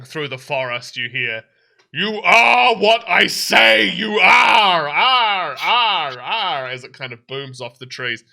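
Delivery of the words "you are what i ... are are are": slow, loud, booming demon roar; booming shout. Acted. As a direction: let it boom off the trees. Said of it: "voice becomes booming and loud".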